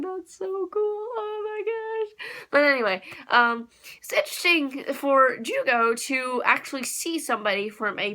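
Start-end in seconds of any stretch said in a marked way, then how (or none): 0.0-2.3 high, soft, drawn-out squeal of delight; gushing fangirl. Retold or imitated. Fan gushing to viewers about the transformation, high and slow. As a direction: coo it, stretching out gosh. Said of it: "I was like".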